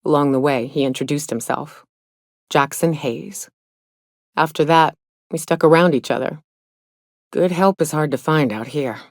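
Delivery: ordinary talk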